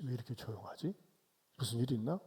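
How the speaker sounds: uneasy murmur. Retold or imitated. Mother wonders why the house is silent, soft murmur. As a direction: mutter it to yourself, uneasy.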